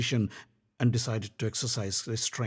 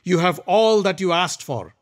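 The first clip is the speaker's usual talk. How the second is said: louder, higher commanding prince; Duryodhan's voice; 7 semitones higher, 6 dB louder than usual. Acted. Duryodhan sending Karna to battle, commanding. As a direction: send him off to win.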